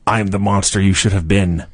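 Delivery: low, even villain line; ominous Vampiro. Acted. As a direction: drop low for a monster's menacing reply. Acting out Vampiro's ominous reply.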